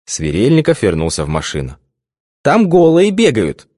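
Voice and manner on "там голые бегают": high, slow, delighted telling; gleeful tattling. A narrator's reading. Svetka tattling to her boss, gleeful tattling. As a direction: tattle with childish glee. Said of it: "радостно наябедничала Светка".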